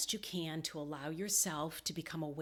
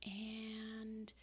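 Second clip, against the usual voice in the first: higher and softer, tightening up; held tension; 5 semitones higher, 7 dB quieter, much slower than usual. Acted. Meditation guide has listeners squeeze their fists, softly straining. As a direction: build a soft, sustained squeeze into the voice. Coaches clenching fists before release.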